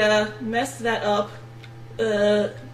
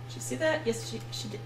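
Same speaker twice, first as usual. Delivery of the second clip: hushed whisper, much softer; 10 dB quieter, much faster than usual. Real, not acted. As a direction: whisper it so no one hears. Worried a student noticed him.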